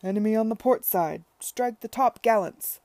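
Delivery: loud naval battle cry; cried out. Acted. Tom playing sea battle with his children, loud cry. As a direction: bawl orders like a captain. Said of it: "cried Tom".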